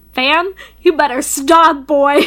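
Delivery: loud, slow, drawn-out playful yell; excited yell. Real, not acted. Gamer yelling at the romance scene, loud and slow. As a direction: shout at the screen in flustered delight.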